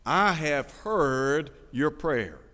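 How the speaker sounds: very slow, every word spaced out; drawn-out solemn quote; slow emphatic reading. Acted. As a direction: let each word land on the congregation. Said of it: "Now listen".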